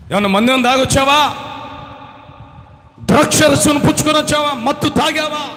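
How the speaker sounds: loud, high scolding; scornful accusing. Acted. Church servant scolding the weeping woman, loud. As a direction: accuse her of being drunk.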